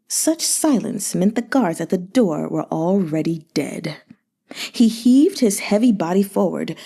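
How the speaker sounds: plain reading voice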